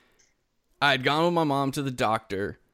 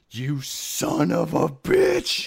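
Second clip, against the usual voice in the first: slow, drawn-out retold curse; incredulous mock anger, every word dragged out; mock outrage; 3 semitones higher, much slower than usual. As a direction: stretch it out in acted betrayal. Retelling outrage at friend's betrayal.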